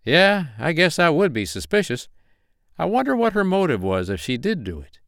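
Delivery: polite Carolina investigator on phone; friendly investigator voice. Acted. Malone fishing for info.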